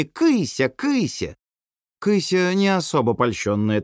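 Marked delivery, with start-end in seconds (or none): 0.0-1.4 put-on high-pitched child calling a cat; toddler's squeals. A narrator's reading. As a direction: squeal after the kitty like a toddler. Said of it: "с воплями". (детские вопли, высоким голоском)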